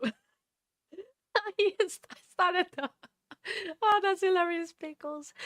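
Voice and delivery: bursting into high laughter; laughing. Real, not acted. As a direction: laugh through the words. Reacting to a funny chat message.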